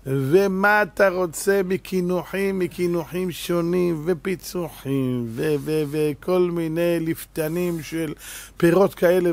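slow savoring list. Acted.

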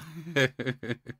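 high-pitched amused laugh. Real, not acted. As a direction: laugh in agreement at the teasing. Amused at being called Jersey boy.

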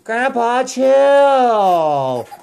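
high, drawn-out mock telling-off; playful exclaiming. Parent teases Daddy and Grandpa to help child, playfully exclaiming. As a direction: sing out the key word, stretched long.

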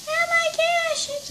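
sudden high-pitched gasp of awe; high excited cry. Acted. Volcano overflowing again.

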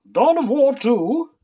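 hammed-up epic announcer; epic title announcer. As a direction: boom the title like a game trailer. Dramatic reading of a book title.

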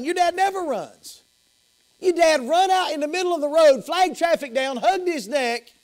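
high, breathless-with-news retelling, acted; excited news-telling. Servant tells brother how Dad ran to the son, excited. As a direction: pile up the astonishing details eagerly.

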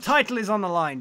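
normal talking voice

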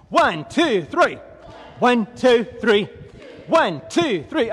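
loud, high exercise count; drill cadence. Real, not acted. Trainer counting side straddle hops for class, shouted cadence.

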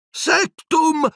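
very high, enraged yell; shouted spell. A narrator's reading. Harry lunging blindly at Piton, shouting. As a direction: hurl out a cut-off incantation.

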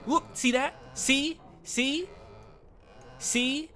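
high teasing repeated see; smug see. Real, not acted. Streamer gloating to chat he was right, teasing.

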